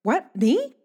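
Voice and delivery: high, loud, panicky stammering; stammering "what, me?" in high alarm; flustered stammer. Acted. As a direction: point at yourself, caught out. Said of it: "I'll point at myself like".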